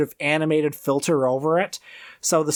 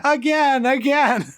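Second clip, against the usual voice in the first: high, giddy demand for an encore; delighted executive; 7 semitones higher than usual. Acted. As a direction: beg for more, laughing. Acting the delighted executive rewatching.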